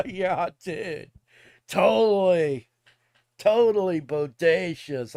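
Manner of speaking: loud, drawn-out dude voice; hammed-up cartoon surfer slang, belted out; Michelangelo. Clowning with old slang for a friend, in a loud Michelangelo voice. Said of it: "I sound like fucking Michelangelo".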